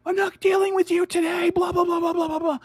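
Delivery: high, loud hammed-up scolding; shrieky caricature of a telling-off; mock yelling mom. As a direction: imitate an angry mother yelling, running into gabble. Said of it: "She's just yelling".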